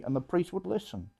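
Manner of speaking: ordinary talk, nothing marked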